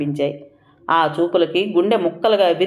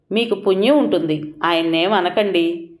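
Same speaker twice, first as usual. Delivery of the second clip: usual speaking voice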